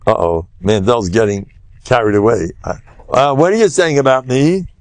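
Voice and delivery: ordinary talk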